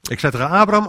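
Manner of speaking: normal talking voice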